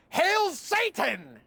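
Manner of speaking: high, drawn-out proclamation; mock yell. Odd customer saluting before shoppers, imitated high yell. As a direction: cry it out long and high like a salute. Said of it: "and yell".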